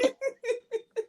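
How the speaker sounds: a brief high chuckle; short real laugh. Real, not acted. Cohost laughs at own joke.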